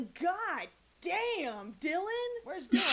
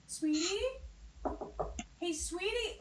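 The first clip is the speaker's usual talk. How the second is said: higher, sing-song mommy voice; sugary mom voice; 5 semitones higher than usual. Pretend. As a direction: coax the child sweetly. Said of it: "what this little girl's life".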